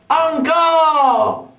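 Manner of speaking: high, drawn-out crowd call; theatrical cheer; acted shout. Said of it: "I went off to shouts of".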